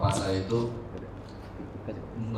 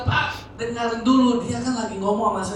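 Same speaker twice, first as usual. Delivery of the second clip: high-pitched exasperated plea, re-enacted; imitated heated appeal in a much higher voice; retold emotional retort; 11 semitones higher than usual. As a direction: act out arguing back at your father. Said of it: "Saya jadi emosi".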